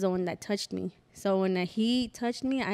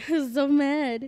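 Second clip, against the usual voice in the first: higher, drawn-out words through a laugh; amused, slow and raised in pitch; 6 semitones higher, 5 dB louder than usual. Real, not acted. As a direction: laugh as you stretch it out. Laughs while recalling her anger.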